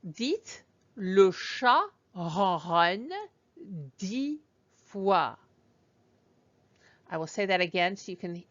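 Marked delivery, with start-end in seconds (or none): 0.1-5.3 dites le chat ronronne said very slowly; slow careful French phrase; slow clear model. Shown as a demonstration. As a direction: spell it out slowly for the learner. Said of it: "And then I say dites".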